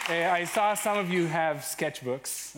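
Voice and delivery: ordinary delivery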